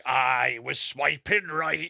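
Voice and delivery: slow, drawn-out pirate impression. Comic pitching pirate Tinder, slow pirate voice. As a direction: play a mock pirate, stretching the words. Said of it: "It's gotta be like".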